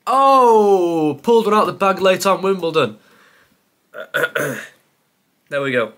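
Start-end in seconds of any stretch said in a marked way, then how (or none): 0.1-3.0 loud, high "oh!" then excited relief; elated outburst. Real, not acted. Quiz player celebrating a late right answer, elated. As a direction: cry out at a last-minute find, higher and louder.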